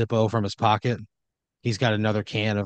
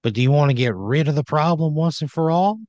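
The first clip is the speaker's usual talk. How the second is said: higher, louder in-character challenge; Randy Wrench; 5 semitones higher, 5 dB louder than usual. Acted. Randy asks player to join him, bold challenge. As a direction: build upward into a bold question. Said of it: "and he says".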